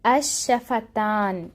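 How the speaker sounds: slow, drawn out term. Shown as a demonstration. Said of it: "آج ہم پڑھیں گے".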